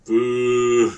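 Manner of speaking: drawn-out jeering boo; loud boo. Acted. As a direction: boo them loudly. Booing again, louder.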